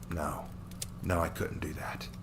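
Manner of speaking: hushed, voiceless refusal; inner whisper. Acted. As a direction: whisper it to yourself. Hero rejects the villain's advice.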